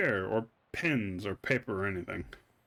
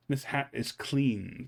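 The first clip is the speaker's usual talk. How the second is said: ordinary delivery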